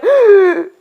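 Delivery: loud panicked gasp; sharp gasp. Shown as a demonstration. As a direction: gasp in hard and fast. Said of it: "If they're going".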